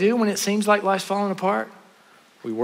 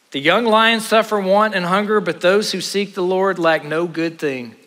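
raised pulpit voice, slowing at the end; high opening, easing into a slower close; emphatic scripture reading; 3 semitones higher than usual. Acted. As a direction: proclaim the verse to the congregation. Reads verse out with force.

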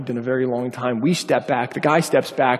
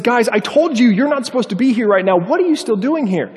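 high-pitched exasperated retelling; mock frustrated scolding, pitched up; more frustrated; 8 semitones higher, 4 dB louder than usual. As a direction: imitate a man losing patience, pitch raised. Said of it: "understandably a little bit more frustrated".

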